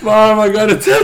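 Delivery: high, loud hammed-up wail; exaggerated tearful confession, laughing; mock crying. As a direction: blubber a confession through laughter. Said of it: "I started crying".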